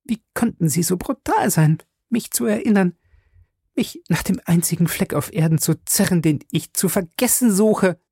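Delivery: high woman's voice, wavering with hurt. Acted. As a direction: reproach him through the pain. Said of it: "Ihre Stimme schwankte". (schwankende, hohe Frauenstimme)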